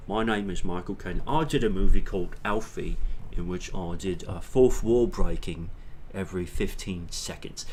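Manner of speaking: Cockney British actor with broad vowels; Michael Caine. Shown as a demonstration. Actor introduces himself and his film, imitated Cockney accent. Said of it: "like, that sort of thing".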